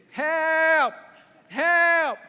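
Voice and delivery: high, very loud held yell; crying out for help. Retold or imitated. Rescuers hear the kid after engines go off, loud imitated cry for help. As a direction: holler for rescue, stretching the word. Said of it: "they hear this kid".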